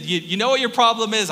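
playful jab at the church people; mock Jesus confronting. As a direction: call them out, half-joking. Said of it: "that's how he said things".

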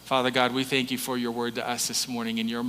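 soft, low prayerful voice. Acted. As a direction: pray quietly and intimately. Pastor prays over congregation.

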